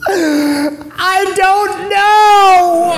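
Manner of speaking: high, loud, drawn-out mock wail; mock crying. Father imitating tearful daughter answering him, hammed-up wail. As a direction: bawl it slowly, hammed up as a weeping girl. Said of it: "she's crying".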